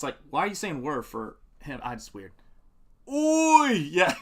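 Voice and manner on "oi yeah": loud high British "Oi!". Retold or imitated. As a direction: shout a cockney Oi. Mock British call.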